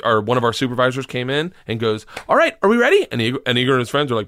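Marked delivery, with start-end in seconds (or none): 2.3-3.1 high, loud mock supervisor; bright chirpy call of an organizer. Supervisor calling the class, high and loud.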